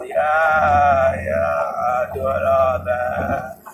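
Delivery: slow dragged-out mock celebrity voice; lazy stretched-out party-girl talk. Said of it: "She was the originator of this whole".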